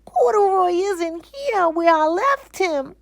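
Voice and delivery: put-on high, loud, slow distressed child; upset little girl. Lisa telling her mother her bear is gone. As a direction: plead as a small girl.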